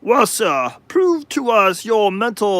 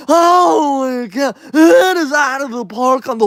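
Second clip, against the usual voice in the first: baseball announcer; 4 semitones higher than usual. Pretend. Mock baseball announcer call.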